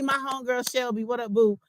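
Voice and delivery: usual speaking voice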